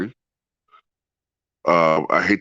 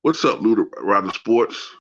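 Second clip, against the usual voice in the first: congested. Real, not acted. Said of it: "I'm congested as hell".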